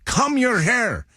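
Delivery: high, drawn-out dad impression with Spanish accent. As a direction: call out slowly in a Spanish accent. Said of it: "dad having a Spanish accent".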